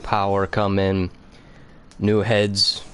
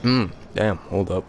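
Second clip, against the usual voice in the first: wolfing down food. Real, not acted. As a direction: mouth full, eating while talking. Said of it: "I am, like, devouring this food right now".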